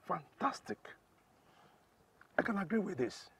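high, loud delighted praise; gleeful. Acted. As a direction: crow over the scandal. Gleeful politician.

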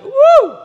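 very high, loud whoop; high, loud cheer of joy; excited whoop. Real, not acted. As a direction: let out a joyful whoop. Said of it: "I get a little excited".

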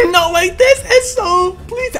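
high, pleading whine; desperate wail. Real, not acted. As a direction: wail it as if all is lost. About to die in game.

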